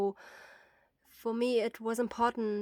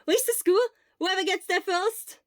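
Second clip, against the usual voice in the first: very high, loud call; 10 semitones higher, 9 dB louder than usual. Acted. Obo challenging friends to race, shouting.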